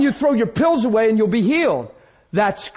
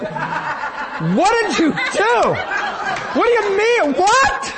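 high-pitched mock outrage; shocked wife; 10 semitones higher, much slower than usual. Wife questioning her husband who says he sinned, shocked. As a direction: imitate a wife's rising, incredulous demand.

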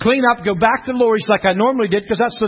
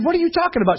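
high-pitched incredulous question; high, puzzled retort; mock bafflement; 11 semitones higher, much faster than usual. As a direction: act out total confusion. Retells his baffled reply.

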